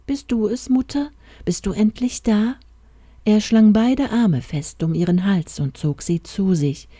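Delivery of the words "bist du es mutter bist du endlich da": put-on high, hopeful child's question; a sick boy wondering if his mother is back. Feverish boy asking for his mother, high and hopeful.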